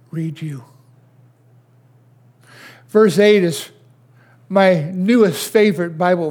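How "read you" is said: unvoiced whisper to close the thought. Real, not acted. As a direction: breathe "read you" as a hushed whisper. Preacher drops to hushed close.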